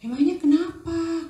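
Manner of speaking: lightly voiced, high mum asking; concerned mother. Mother asking her son why, lightly voiced high. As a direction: gently press for the reason. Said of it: "kata maminya".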